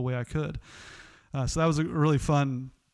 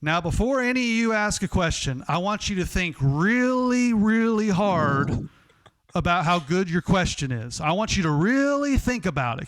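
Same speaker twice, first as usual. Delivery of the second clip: Bob Knight; 5 semitones higher, 4 dB louder than usual. Retold or imitated. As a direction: drag out each "really" like a threat. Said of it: "and he goes".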